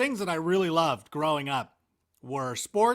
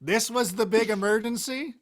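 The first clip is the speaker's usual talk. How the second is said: raised, incredulous retold question; mock incredulity; 5 semitones higher than usual. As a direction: act out disbelief at a false alarm. Retold kid's disbelief, after laugh.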